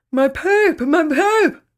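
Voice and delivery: "My poop" shouted high and loud with no teeth, for "my teeth"; toothless shout. Acted. Grandad who lost his teeth shouts to the girls, toothless. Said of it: "Grandpa shouted".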